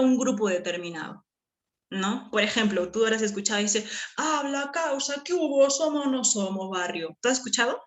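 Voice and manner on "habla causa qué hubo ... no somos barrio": slangy street friend. Retold or imitated. Teacher imitating street slang for her class. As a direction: greet your buddy like a Lima street kid. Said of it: "¿Quiénes hablan así?".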